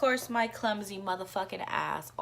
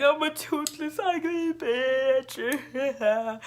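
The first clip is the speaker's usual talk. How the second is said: high mock-dramatic self-insult, drawn out; joking; 7 semitones higher, 4 dB louder, much slower than usual. Joking to friends about her broken tooth, a high drawn-out mock lament. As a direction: ham up the self-pity as a joke. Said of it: "I started joking about it".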